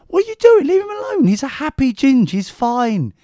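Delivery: shrill up like a meddling woman; hammed-up high nurse voice telling him off; mimicking the nurse. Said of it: "she comes".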